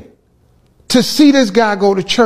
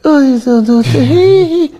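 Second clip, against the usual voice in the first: high, halting mock Michael voice; Michael impression; 7 semitones higher, 3 dB louder than usual. Telling friends about Mike, mimics his halting high voice. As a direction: stammer it out in a high put-on voice.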